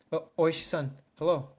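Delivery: a frightened man clutching at help; panicked voice. Acted. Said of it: "sense something wrong in Maibara-kun's voice".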